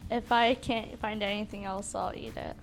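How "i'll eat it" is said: normal talking voice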